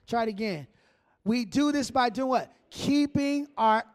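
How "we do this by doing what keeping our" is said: congregation reads out louder; louder reading. Real, not acted. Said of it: "say that a little bit louder".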